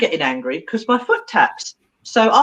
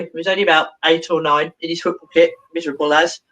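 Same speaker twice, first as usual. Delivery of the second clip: ordinary delivery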